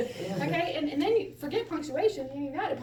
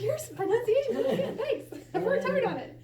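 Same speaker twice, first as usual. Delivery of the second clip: much higher, hurried voice through laughter; mock chit-chat; 10 semitones higher, 4 dB louder than usual. Learner complimented on pronunciation, high mock-pleased exchange. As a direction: play both sides high and giggly. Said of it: "And they're like".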